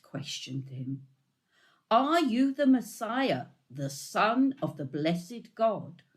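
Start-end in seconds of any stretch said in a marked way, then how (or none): 1.9-6.0 high, loud challenge that falls off; the high priest presses him hard; a loud, high, demanding priest; high priest's demand. A narrator's reading.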